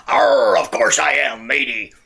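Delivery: stagey pirate voice. Acted. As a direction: answer like a hammy pirate. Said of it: "I had to get the pirate in there".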